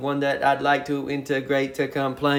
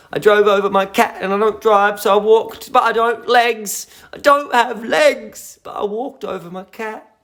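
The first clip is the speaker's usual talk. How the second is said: mock excited recap; 7 semitones higher, 5 dB louder than usual. Mocking viewer's absurd claims.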